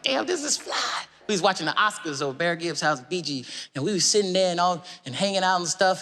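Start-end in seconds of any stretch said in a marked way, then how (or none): 0.0-1.0 awed reaction. Retold or imitated. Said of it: "I was like".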